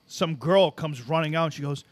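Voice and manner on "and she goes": ordinary talk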